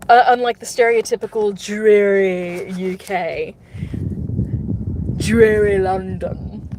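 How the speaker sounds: slow, lightly put-on British accent; a bad stab at a London accent, drawn out; terrible British accent. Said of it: "In a terrible accent".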